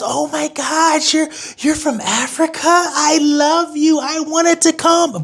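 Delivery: high, drawn-out gushing mimicry; mock gushing affection.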